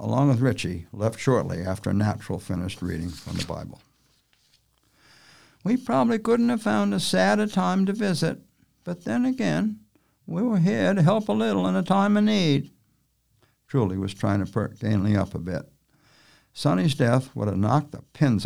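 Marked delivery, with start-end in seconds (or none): 5.6-12.7 gentle woman voice. Acted. Truly speaks in character.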